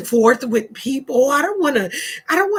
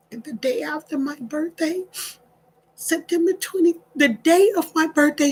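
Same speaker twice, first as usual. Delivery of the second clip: real tearful crying; 2 semitones higher than usual. Real, not acted. Speaker overcome at reaching a thousand listeners, tearful. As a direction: push the words out while crying. Crying over the audience gained.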